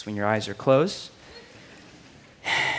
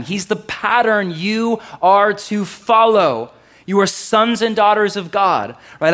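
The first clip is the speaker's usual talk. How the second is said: loud, higher-pitched preacher's exhortation; forceful preaching; 7 semitones higher, 8 dB louder than usual. Real, not acted. Preacher urging the congregation to follow Jesus, emphatic preaching. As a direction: drive the teaching home to the congregation.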